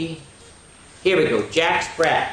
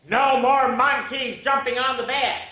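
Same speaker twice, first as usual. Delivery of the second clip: doctor's stern voice; 9 semitones higher, 3 dB louder than usual. Acted.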